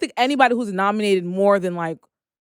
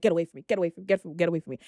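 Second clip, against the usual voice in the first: muttered brush-off; 5 semitones lower, 8 dB quieter, much faster than usual. Retold or imitated. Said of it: "I'd be like".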